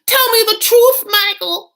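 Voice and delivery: high, loud mock shriek; hammed-up, shrieked demand; very upset. Wife confronting Michael on the phone, hammed-up upset shriek. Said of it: "like, very upset".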